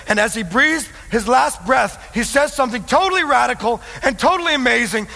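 high, forceful sermon voice; impassioned preaching. Real, not acted. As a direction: preach it in astonishment at full force.

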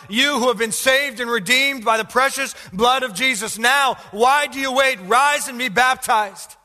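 high, loud, drawn-out appeal; urgent preaching exhortation. Real, not acted. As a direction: press the church to act, full force. Exhorts the congregation to act.

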